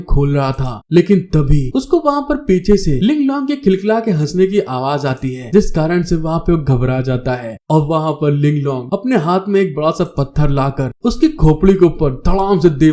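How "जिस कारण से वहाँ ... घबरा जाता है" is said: ordinary delivery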